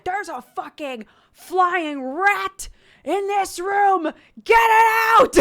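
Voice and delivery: high, loud yell, building to a bellow; furious shout. Retold or imitated. Dad storming into the room, a high, loud, furious yell. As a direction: roar at the bird like it invaded your house.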